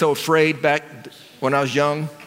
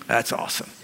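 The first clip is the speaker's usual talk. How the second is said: sighed out, much softer; breathy sigh; 10 dB quieter than usual. Real, not acted. Preacher savouring being chosen, soft sigh. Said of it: "how does that feel?".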